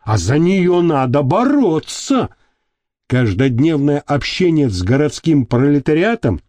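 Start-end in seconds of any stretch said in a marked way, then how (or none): none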